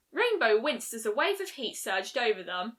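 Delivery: ordinary delivery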